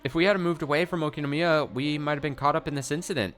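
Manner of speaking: put-on high-pitched chatty girl voice; cheerful. Girl chatting to distract grandma, cheerful. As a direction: keep it light and perky. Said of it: "speaking to her in a cheerful voice".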